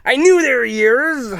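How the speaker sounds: high, loud and vindicated; gleeful at being proved right; triumphant shout. Acted. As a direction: crow that you were right all along. Triumphant over finding the ears.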